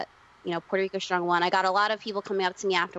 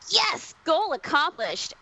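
higher, slow, smug victory line; mock triumphant; 8 semitones higher, much slower than usual. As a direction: savor it like a mission complete. Mom says book made her cry.

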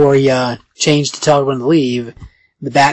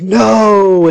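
loud, higher mock "no"; acted cry of protest; 5 semitones higher, 5 dB louder than usual. Clay being cut open by Bill, acted cry. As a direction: cry out in hammed-up dismay.

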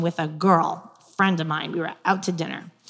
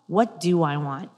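normal talking voice

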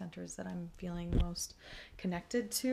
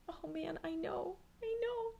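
much higher, soft, climbing squeak; nervous squeak; 12 semitones higher, 4 dB quieter than usual. Retold or imitated. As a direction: squeak it out, higher each time. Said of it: "And I was like".

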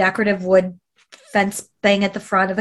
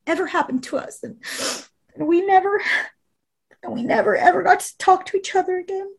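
high, breaking voice pleading with her sister; tearful pleading; 10 semitones higher than usual. Real, not acted. Retelling her plea to her sister, voice high and breaking. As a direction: choke back tears as you beg.

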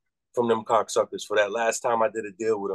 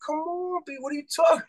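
much higher, fast incredulous squeal; disbelieving. Retold or imitated. To the WWE guys, disbelieving high squeal. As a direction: react like it's a ridiculous ask.

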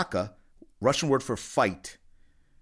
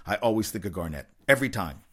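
ordinary delivery

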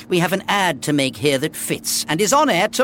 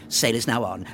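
"Sail is now on" tossed off lightly and lower; throw away; 6 semitones lower than usual. Shown as a demonstration. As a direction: throw the line away, no weight. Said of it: "but throw away".

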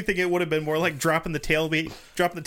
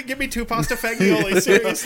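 laughing; 6 semitones higher, 6 dB louder than usual. Pretend. Mock order, laughing.

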